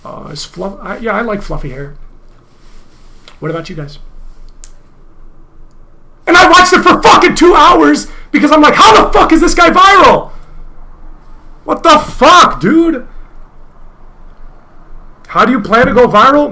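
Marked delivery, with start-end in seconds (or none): 0.0-4.0 very quiet, low-key mimicry of a streamer; hushed mock streamer small talk; imitating streamer. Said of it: "This is what he said". 6.2-13.1 loud, high-pitched outburst of disbelief; worked-up incredulous rant; exasperated shouting. Real, not acted. Ranting about the boring viral stream.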